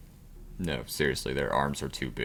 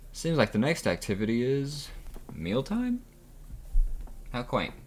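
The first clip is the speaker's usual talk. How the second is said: snooty voice; 8 semitones higher than usual. Acted. Character sneering.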